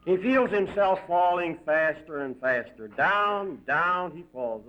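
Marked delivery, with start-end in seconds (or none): none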